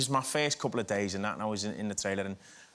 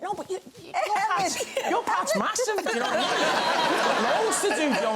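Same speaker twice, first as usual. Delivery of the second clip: much higher, laughing and flustered; nervous laughing; 3 dB louder than usual. Retold or imitated. Actor retells flustered reply to Joe Pesci, high and laughing.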